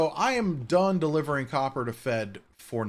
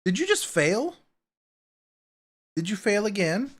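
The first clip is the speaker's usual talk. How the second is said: high, louder playful teasing; teasing the cat; 8 semitones higher, 6 dB louder than usual. Real, not acted. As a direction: poke fun at the cat's flop. Teasing the cat who failed.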